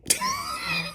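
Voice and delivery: very high, soft squeal of laughter. Real, not acted. Amused after imitating the begging, soft high-pitched laugh.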